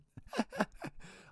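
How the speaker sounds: squeaky little laugh; high giggle breaking out; high-pitched giggle. Real, not acted. Laughing at own music joke.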